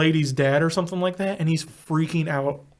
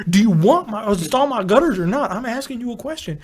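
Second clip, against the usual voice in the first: higher, indignant demand; mock exasperation; 5 semitones higher than usual. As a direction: act fed up with the gutter man. Acted argument with gutter man.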